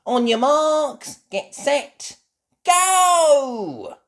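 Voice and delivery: high, loud race call; starting shout. Acted. Freddy Fox starting the sack race, loud. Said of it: "shouts Freddy".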